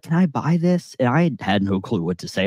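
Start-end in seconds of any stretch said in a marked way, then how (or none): 0.0-1.0 put-on lightly higher, gentle old lady voice; a touch of a sweet grandma asking. Old lady politely asking the worker, lightly voiced. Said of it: "she was like".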